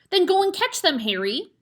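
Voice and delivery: high, loud pushy hammed-up hiss; urgent hiss. A narrator's reading. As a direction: shove her husband into action. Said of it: "hissed the mother".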